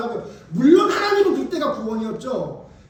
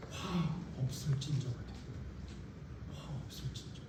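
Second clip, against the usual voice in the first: hushed, much softer and lower; solemn hush; 10 semitones lower, 17 dB quieter than usual. Real, not acted. Preacher intoning to congregation, fading hush. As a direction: breathe the words almost silently.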